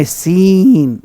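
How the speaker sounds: सीन with a drawn-out ee; slow, lengthened "ee" in सीन; long ee sound. Shown as a demonstration. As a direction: stretch the vowel in सीन, "seeeen". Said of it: "ई करने हैं उच्चारण".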